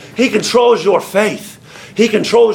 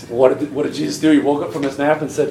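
normal talking voice

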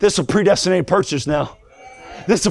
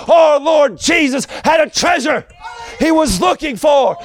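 full-force preaching; 6 semitones higher than usual. Acted.

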